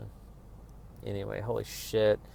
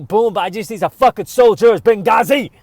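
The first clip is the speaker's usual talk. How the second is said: mock fired-up friend; 8 semitones higher, 13 dB louder than usual. Acted quote of a loyal friend.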